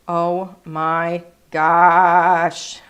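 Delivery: drawn-out, breathy oh my gosh; slow gushing delight; delighted gasp. Real, not acted. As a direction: melt over how cute it is. Gushing over a cute stamp.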